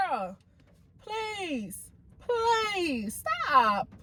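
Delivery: high, drawn-out mock begging; exasperated plea. Host reacting to a matchmaker's fake laugh, exasperated plea. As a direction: plead in a high voice for it to end. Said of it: "it's just like".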